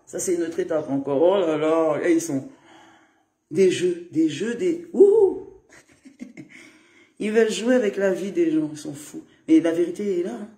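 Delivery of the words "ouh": shocked hoot. Real, not acted. Exclaims about the games.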